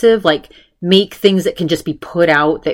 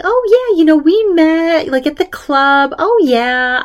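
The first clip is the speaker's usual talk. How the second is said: much higher, louder breezy hostess; imitating Susie; 11 semitones higher, 3 dB louder than usual. Susie telling Andrea they met at the club, high breezy voice. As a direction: sing it out like a carefree host.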